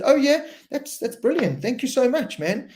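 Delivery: lightly imitated delighted Troy; imitating Troy. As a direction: pitch up to play the grateful rider. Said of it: "And he said".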